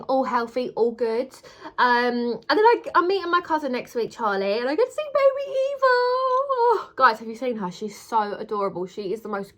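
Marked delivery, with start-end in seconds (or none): 2.5-6.9 excited. Real, not acted. Excited about seeing baby niece.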